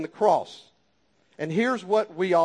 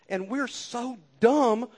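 ordinary talk, nothing marked

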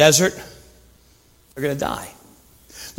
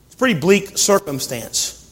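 normal talking voice

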